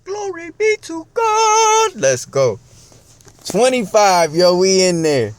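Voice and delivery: very high, loud, slow celebration; triumphant shout. Retold or imitated. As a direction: belt it out like you won.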